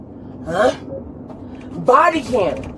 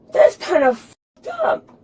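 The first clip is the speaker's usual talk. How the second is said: crying; 6 semitones higher than usual. Real, not acted. Arrested woman breaks down.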